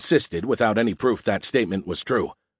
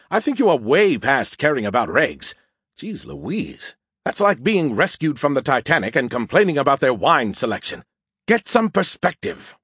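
higher, incredulous scolding; snarky Skippy, exasperated; 4 semitones higher, 3 dB louder than usual. Acted. Skippy mocks Joe's worry about regulations, exasperated. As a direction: lecture him in an animated, higher voice.

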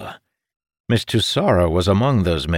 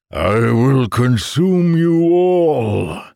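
slow, higher, drawn-out monster threat; bellowing monster; 5 semitones higher, 4 dB louder, much slower than usual. A narrator's reading. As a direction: roar it long, as a devouring beast. Monster threatens the fleeing soldiers.

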